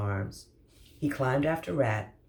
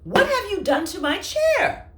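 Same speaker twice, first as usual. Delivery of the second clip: much higher, louder outraged cry; dismayed shout; 10 dB louder than usual. Acted. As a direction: cry out over the ruined chair. Sloth dismayed at broken chair.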